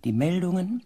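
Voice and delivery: ordinary delivery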